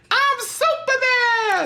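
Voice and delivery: very high, slow mock-kid yell; squealed superhero boast; shouting kid. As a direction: imitate a kid proudly hollering, stretched out. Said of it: "that kid is like".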